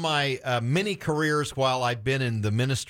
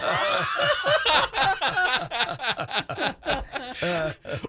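a long, real burst of laughter; hearty high laugh; 11 semitones higher than usual. Real, not acted. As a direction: genuine delighted laughter. Host laughs at guest's reply.

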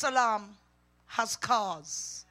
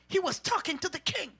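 ordinary talk, nothing marked

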